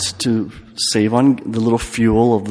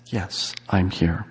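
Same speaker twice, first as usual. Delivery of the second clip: slow hushed answer of comfort; soft, slow reassurance; 4 semitones lower, 8 dB quieter, slower than usual. Acted. God answering the soul, slow and hushed. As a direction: answer slowly, like a gentle presence.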